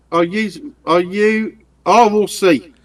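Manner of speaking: ordinary delivery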